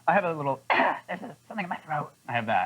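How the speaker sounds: croaky fake-sick voice, throat clearing. Shown as a demonstration. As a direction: put on a weak sick-day voice. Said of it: "You have a call in sick voice?".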